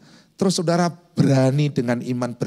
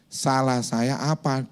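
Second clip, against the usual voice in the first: slow, soft, polite ask; quiet, unhurried and innocent; gentle question; 3 semitones lower, 4 dB quieter, much slower than usual. Retold or imitated. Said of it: "Saya cuma tanya".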